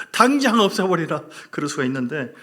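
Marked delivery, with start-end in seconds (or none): 0.1-1.2 Jeolla-dialect order, louder and higher. Pretend. 전라도 사투리로 외치는 명령. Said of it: "전라도 버전으로".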